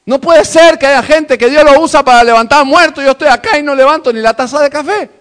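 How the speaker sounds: loud, high shouted preaching; preacher shouting at the congregation, loud and high. Acted. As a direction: shout it from the pulpit.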